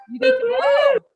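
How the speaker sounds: high, loud rally cry to the crowd; cheering shout. Real, not acted. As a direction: pump the audience up with a shout. Crowd call-out to Greeley.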